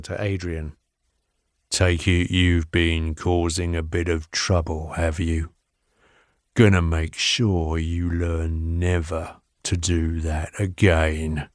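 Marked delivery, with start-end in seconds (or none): 1.7-11.5 low, slow threatening growl; low menacing voice. A narrator's reading. Jones threatens Adrian.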